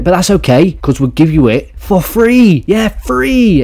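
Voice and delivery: high, squealed hard sell; mock sales pitch. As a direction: pitch it up high as an absurd giveaway.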